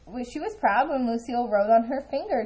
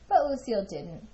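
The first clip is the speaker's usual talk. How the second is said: ordinary delivery, nothing marked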